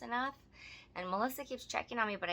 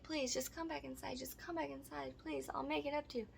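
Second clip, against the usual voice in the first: soft, high-pitched begging; mock pleading; 7 semitones higher, 7 dB quieter than usual. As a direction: imitate him pleading, small high voice. Mimics man begging her to return.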